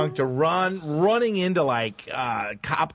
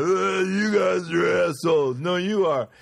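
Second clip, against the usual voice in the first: mock yell, a little raised; half-shouted taunt across a yard; slurred-out, drawn heckle in imitation; retold drunken yelling; 4 semitones higher than usual. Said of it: "we were yelling at them".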